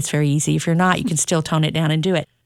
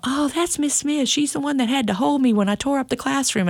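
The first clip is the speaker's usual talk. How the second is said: put-on a child explaining the teacher to his mom, pitched up; cheerful child; 8 semitones higher than usual. Kid introduces teacher to mom.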